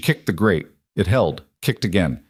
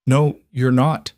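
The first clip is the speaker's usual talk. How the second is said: wrecked voice; 4 semitones higher, slower than usual. A narrator's reading. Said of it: "His voice was wrecked".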